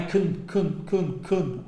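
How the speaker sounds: can said quick and reduced, almost no vowel, like kn; short weak can. Shown as a demonstration. Teacher showing students the reduced can, said short and weak. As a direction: clip can to a tiny kn with the vowel nearly gone. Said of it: "casi no hay un sonido vocal".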